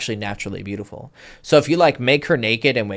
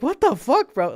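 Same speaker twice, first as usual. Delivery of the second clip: amazed friend. Retold or imitated. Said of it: "He's like".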